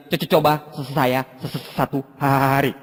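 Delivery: low, subdued mimicked stammer; stuttering job applicant. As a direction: stick on the first sound of each word. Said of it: "dia gagap".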